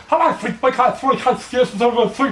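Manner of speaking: spitting into the microphone; Trevor. Shown as a demonstration. Mocking a radio amateur who talks too close, spitting. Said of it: "spitting all the time".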